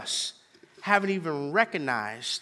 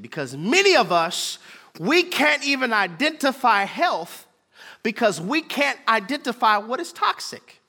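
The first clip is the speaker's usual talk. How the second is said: building to high, loud exhortation; forceful preaching; 7 semitones higher, 5 dB louder than usual. Real, not acted. Preacher warning many who can't identify health, forceful preaching. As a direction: climb into a ringing challenge.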